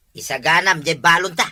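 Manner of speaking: ordinary talk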